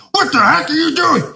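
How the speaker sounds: high mock yell of a furious guard; retold yell. Jacked guard yelling at the surveyor, in a hammed-up high mock yell. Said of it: "interrogating us at the top of his lungs".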